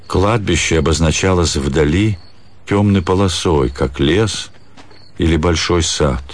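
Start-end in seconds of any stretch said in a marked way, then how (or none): none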